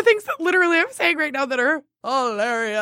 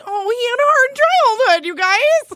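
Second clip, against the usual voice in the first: mock sympathetic voice; 12 semitones higher, 4 dB louder than usual. Mocking friend's sympathy.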